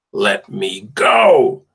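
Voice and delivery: loud, slow, drawn-out cry to be let go; exploding cry. A narrator's reading. Captive girl yelling at her captor, loud cry. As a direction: burst out, pulling against his grip. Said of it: "Amari exploded".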